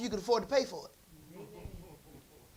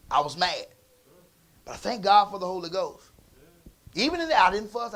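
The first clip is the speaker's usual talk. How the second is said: ordinary talk, nothing marked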